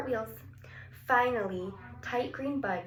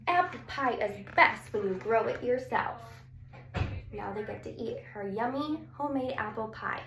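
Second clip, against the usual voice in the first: plain reading voice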